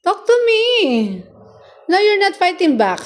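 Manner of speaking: high coaxing. Real, not acted. Owner pleading with her silent pet in a high voice. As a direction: coax the pet to answer.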